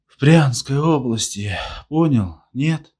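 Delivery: weepy insistence. A narrator's reading. Weepy drunk insists to his friend.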